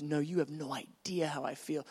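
low, soft insistence; tender voice of God. Acted. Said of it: "He goes".

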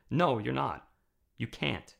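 ordinary delivery

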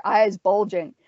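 ordinary delivery

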